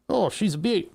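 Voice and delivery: hammed-up Australian "Oh, she's a beaut"; Australian accent. Streamer admiring his wiring in a terrible Australian accent. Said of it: "Australian accent, ... it's terrible".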